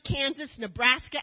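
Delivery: usual speaking voice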